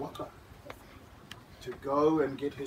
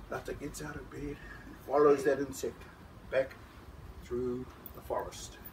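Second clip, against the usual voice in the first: usual speaking voice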